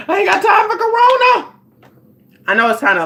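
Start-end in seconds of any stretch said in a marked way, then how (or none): 0.1-1.5 high, loud panicked whine; hammed-up fear of catching corona; mock panic. Vlogger acts out her panic about corona before the trip. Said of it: "but I was like".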